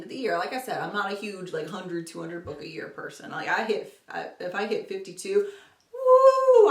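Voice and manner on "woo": high, loud mock woo of triumph; little cheer. To viewers, celebrating fifty-two books, playful loud whoop. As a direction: whoop like you just won.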